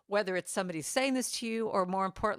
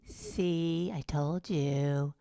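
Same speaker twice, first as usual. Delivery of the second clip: slow, drawn-out told you; a hint of a smug slow taunt; taunting inner critic; 3 semitones lower, much slower than usual. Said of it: "inner critic that comes in and goes".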